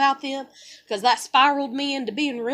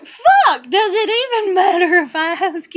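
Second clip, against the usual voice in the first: a loud, high-pitched curse; exasperated outburst; 4 semitones higher, 7 dB louder than usual. Real, not acted. Fed up with nobody helping, a loud outburst. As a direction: let the frustration burst out.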